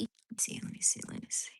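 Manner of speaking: soft whispered murmur. Real, not acted. Woman whispering while checking the dog.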